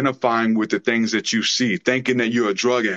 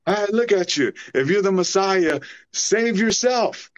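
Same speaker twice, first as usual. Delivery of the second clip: high sneering taunt, much higher than his own voice; mocking thief; 7 semitones higher than usual. Dying thief on the cross taunting Jesus, high mocking sneer. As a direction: jeer at him like a dying thief scoffing at a fake king. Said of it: "mocked him with his dying breath".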